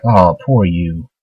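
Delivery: slow, low, sarcastic pity; cold mockery. [name] coldly mocks Spies for his doubts, slow sarcastic pity. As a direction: drip with fake sympathy, slowly.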